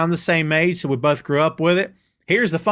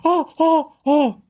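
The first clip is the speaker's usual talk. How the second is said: high, punchy crowd "oh"; imitated crowd chant; 10 semitones higher, slower than usual. Host imitating fans reacting to People's Champion, high crowd chant. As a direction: mimic everyone going "oh, oh, oh". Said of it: "that's gonna get everybody going".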